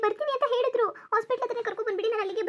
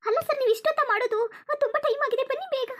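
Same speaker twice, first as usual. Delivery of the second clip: higher, crying complaint to the driver; tearful plea; 6 semitones higher than usual. Acted. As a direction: scold him through tears. Scolding the late ambulance driver. (ಅಳುತ್ತಾ ambulance ಚಾಲಕನನ್ನು ಬೇಗ ಬರಲು ಕೇಳುವುದು)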